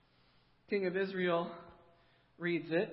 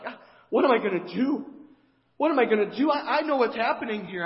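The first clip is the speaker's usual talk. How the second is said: loud, higher frantic wailing; distraught panic; 4 semitones higher, 8 dB louder, faster than usual. Retold or imitated. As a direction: cry out like a king at his wits' end. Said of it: "Utterly distraught".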